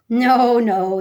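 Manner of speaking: loud, firm double no; insisting. A narrator's reading. Lords insisting to the other lord, loud and firm. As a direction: shut the idea down. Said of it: "insisted the other lords".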